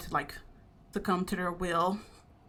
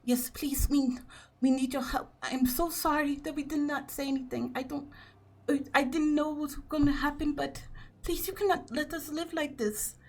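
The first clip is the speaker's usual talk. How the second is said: high, soft, desperate plea; pleading mother; 7 semitones higher, 3 dB quieter than usual. Acted. The mother begs Darius to help the family, high and hushed. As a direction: beg in a small high voice with a hushed start. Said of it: "your mother ... nods along, and is like".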